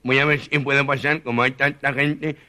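usual speaking voice

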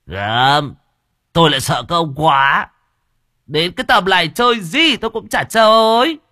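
high, loud tipsy protest; drunk refusal. A narrator's reading. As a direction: wave them off, tipsy.

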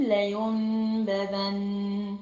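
لا ينبذن with the n turned to m and a two-count hum; very slow Quran recitation, nasal hold; iqlab with ghunna. Shown as a demonstration. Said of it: "صوت غنة كده مع الميم".

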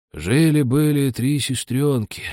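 ordinary delivery, nothing marked

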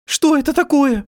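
very high, loud startled cry; shrieked, panicked question; alarmed cry. A narrator's reading. Frightened wife at door, iron rain.

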